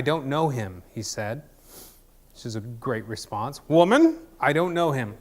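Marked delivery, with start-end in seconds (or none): 3.7-5.2 hammed-up indignant denial, punched opening word; seventies Peter. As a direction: exclaim the first word high and loud, then settle. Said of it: "it's just like the seventies Peter".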